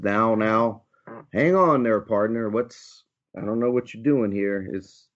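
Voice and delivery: startled Western cowboy, stalling; Western twang. Acted. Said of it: "the cowboy seems to have the twang of the West".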